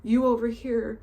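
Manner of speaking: ordinary talk, nothing marked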